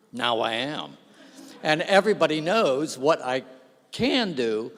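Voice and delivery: ordinary talk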